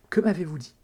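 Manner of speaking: mild astonishment at what was just said; stunned. A narrator's reading. Woman questioning the arresting cop, stunned. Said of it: "qui ne fut que stupéfait".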